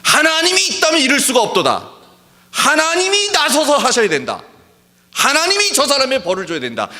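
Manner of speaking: high, sneering voice of a self-righteous complainer; mocking quote. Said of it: "간혹 보면은요". (남을 정죄하는 사람을 높은 목소리로 비꼬듯 흉내)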